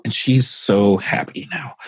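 usual speaking voice

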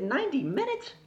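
high, incredulous question; shocked echo. Retold or imitated. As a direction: echo it back in disbelief, pitch shooting up. Her shocked echo of the answer.

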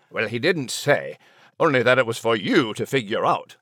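shrugging Scottish lilt; Scottish dog. Acted.

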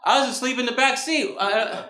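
high, whiny mock complaint; woken-up passenger. Passenger asleep in the back seat, high put-out protest. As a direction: protest high, as if dragged from sleep.

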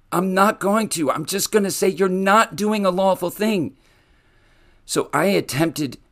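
holding back tears while speaking; emotional. Real, not acted. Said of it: "I'm getting emotional".

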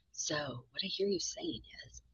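soft, slow mock-clinical voice; slowly, quietly parody a condescending therapist. Counselor mimicking stock clinical talk to a client, condescending. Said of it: "It sounds so condescending to me".

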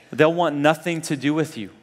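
usual speaking voice

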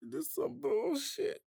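mock wail. Saints fan mourns missing the Super Bowl, in a mock cry. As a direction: wail it like it truly hurts.

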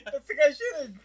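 high loud delighted yell; real delighted cry; excited shout. Real, not acted. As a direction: blurt out in glee. Excited recognition.

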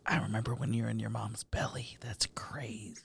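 hushed, breath-only marvelling; awed whisper. Retold or imitated. Uncle marvelling at his texting nephew, whispering in awe. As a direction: whisper it as if amazed.

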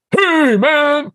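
a high, loud mock yell of hey man; mock aggressive shout. Telling a podcast host what he didn't yell, mock loud shout. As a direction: play the hothead he wasn't being. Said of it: "I'm not like".

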